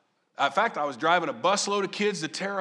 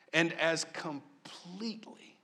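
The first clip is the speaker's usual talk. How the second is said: breathy hushed whisper, slow; slow hushed whisper on the last words; 5 dB quieter, slower than usual. Acted. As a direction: drop to a slow whisper to land the point. Preacher whispers scripture line.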